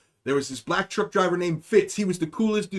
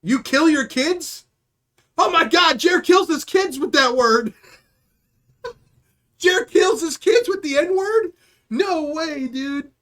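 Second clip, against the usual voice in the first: high, slow disbelief; incredulous; 7 semitones higher, 3 dB louder, slower than usual. Real, not acted. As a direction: stretch it out in disbelief. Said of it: "reacting to chat, disbelief".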